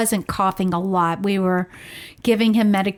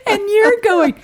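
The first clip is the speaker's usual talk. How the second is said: laughing; 11 semitones higher, 5 dB louder than usual. Real, not acted. Laughing while retelling.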